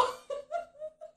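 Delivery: squealing laugh far above her voice; high-pitched giggle. Real, not acted. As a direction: burst into a shrieking giggle. Laughing at the memory.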